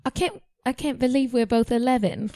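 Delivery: British character line at an even pitch; British accent. Acted. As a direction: read it as the British twin. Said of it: "I had the British one go".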